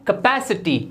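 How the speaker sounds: कैपेसिटी as "kuh-PASS-i-tee"; short neutral first vowel in कैपेसिटी; correct pronunciation. Shown as a demonstration. Teacher models for learners, कैपेसिटी said "kuh-PASS-i-tee". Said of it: "ये होता है".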